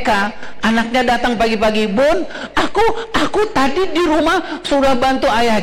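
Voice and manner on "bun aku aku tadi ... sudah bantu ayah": lightly voiced eager little child, higher; small child. Child telling teacher he helped dad, eager and high. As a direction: chirp it like a proud kindergartener.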